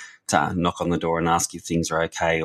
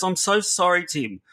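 imitated upset boy, high-pitched; tearful apology; 12 semitones higher than usual. Crying boy apologises to his youth worker, tearful. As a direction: plead an apology, pitch falling at the end. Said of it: "he burst into tears".

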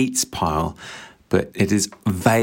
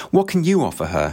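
sharp, higher, angry demand; a touch of anger in a read-aloud challenge; angry question; 7 semitones higher than usual. A narrator's reading. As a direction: throw the question at him in anger. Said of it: "he asked with anger".

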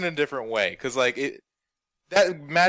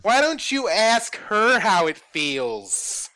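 put-on bubbly sorority girl; 6 semitones higher, much slower than usual. Mocking Nia Jax voice.

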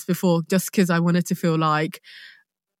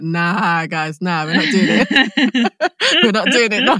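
laughing refusal; 5 dB louder than usual. Retold or imitated. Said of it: "and I was just like".

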